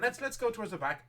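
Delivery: brisk seamstress. Acted. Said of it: "And she says".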